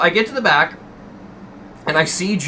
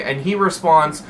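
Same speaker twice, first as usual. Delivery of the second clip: ordinary delivery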